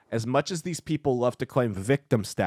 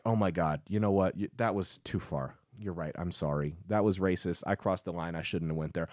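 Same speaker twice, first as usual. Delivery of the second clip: soft, lower mock apology; hypothetical meek apology; 6 semitones lower, 8 dB quieter than usual. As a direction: back down quietly, imitating a cowed apologizer. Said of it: "if I would have been like".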